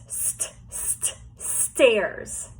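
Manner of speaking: "St" voiceless and soft, repeated, then "stairs"; sounding out. Shown as a demonstration. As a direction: hiss "st" with breath only, then say the word. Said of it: "This is a set of stairs".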